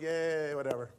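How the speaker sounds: low, listless mock cheer; unimpressed crowd. Crowd answering a fluffy sermon, unimpressed. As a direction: imitate a bored audience giving a half-hearted yay.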